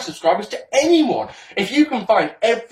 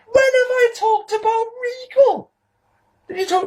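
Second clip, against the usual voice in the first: pitched way up in disbelief; incredulous outburst. Real, not acted. Ranting at Spaz over Regal, incredulous high squeak. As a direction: squeak the question in outrage.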